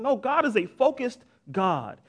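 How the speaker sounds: ordinary talk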